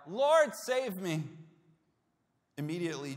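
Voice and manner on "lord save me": slow, desperate plea, a touch higher; crying out for rescue. Acted. Peter sinking, crying out to Jesus for rescue. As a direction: plead as you sink. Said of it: "cried out".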